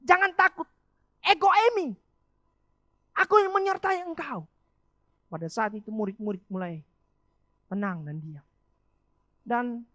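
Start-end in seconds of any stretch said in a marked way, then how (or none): 0.0-4.4 raised, high, commanding proclamation; emphatic reassurance. Retold or imitated. Jesus calls to disciples on the water, loud proclamation. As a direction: proclaim it forcefully to frightened followers.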